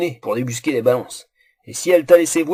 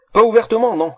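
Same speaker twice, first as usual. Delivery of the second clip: ordinary delivery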